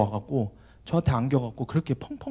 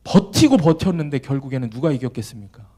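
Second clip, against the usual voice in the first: ordinary delivery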